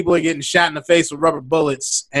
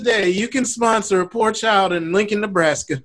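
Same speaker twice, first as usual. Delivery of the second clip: put-on earnest sponsor-a-child appeal; mock charity commercial pitch; 5 semitones higher than usual. Parody of charity ad.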